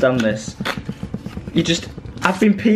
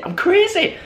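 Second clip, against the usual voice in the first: high-pitched crazed exclamation; playful manic squeal; excited outburst; 14 semitones higher than usual. Pretend. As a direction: burst out high, as if losing it. Hyping himself up over prizes.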